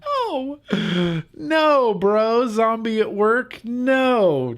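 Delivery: high-pitched, incredulous refusal; indignant denial. Real, not acted. Vlogger rejecting a viewer's lookalike comment, indignant.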